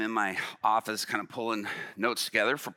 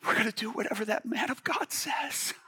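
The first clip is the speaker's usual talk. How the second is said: high, hammed-up weepy voice; mock tearful; 12 semitones higher than usual. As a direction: squeeze it out high, as if overcome with tears. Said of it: "tears begin to flow down his face".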